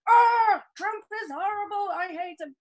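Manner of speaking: high mock wail, fading at the end; hammed-up outrage at Trump; mock shout of hatred that fades out; mock upset shout. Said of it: "she's not gonna go".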